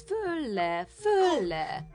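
"föl-le" drawn out in a high, falling swoop; stretched, bouncing pitch on "föl-le"; up-and-down emphasis. A narrator's reading. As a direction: make "föl-le" leap up high and drop. Narrator mimics creature's jumping.

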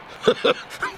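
short mocking chuckle; mocking laugh. Stationmaster scoffing at the dispatcher's fame, mocking laugh. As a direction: chuckle at your own jibe.

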